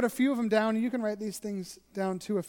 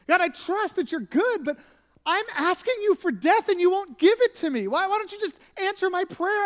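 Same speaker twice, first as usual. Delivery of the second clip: raised, pleading voice of Job, much higher and louder; Job pleading with God; 8 semitones higher, 6 dB louder than usual. Retold or imitated. Job crying out to God, begging for death, high and loud. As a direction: cry out to God like a desperate man begging to die. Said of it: "He's like".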